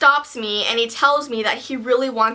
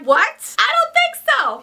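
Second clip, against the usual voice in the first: shocked disbelief; 12 semitones higher, slower than usual. Retold or imitated. Reacting to creepy request.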